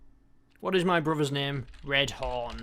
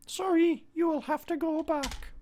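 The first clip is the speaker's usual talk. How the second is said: much higher, odd put-on gatekeeper voice; 13 semitones higher than usual. Gatekeeper demanding an answer before letting the player pass, in a much higher put-on voice. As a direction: play a fussy little gatekeeper guarding his road.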